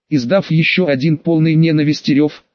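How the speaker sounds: ordinary delivery, nothing marked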